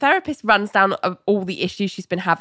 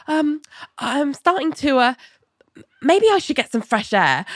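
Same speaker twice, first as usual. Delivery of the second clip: mildly flustered, hesitant and higher; 7 semitones higher than usual. Retold or imitated. Ali answering Elaine's "what's wrong", mildly flustered. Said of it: "she's clearly kind of flustered".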